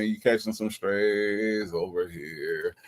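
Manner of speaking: soft, slow repeat of You catching some strays; quiet aside. Real, not acted. Streamer repeating the tease to Sonya, soft slow aside. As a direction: murmur it under the breath.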